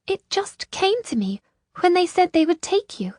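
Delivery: high female character voice; high, modest voice; earnest Isolde. A narrator's reading. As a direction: explain earnestly, pitched well up.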